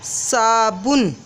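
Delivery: very slow, each syllable clear; stretched teacher word; slow model word. Shown as a demonstration. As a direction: say it slowly for the class. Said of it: "हम एक शब्द लेते हैं".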